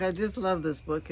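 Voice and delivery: usual speaking voice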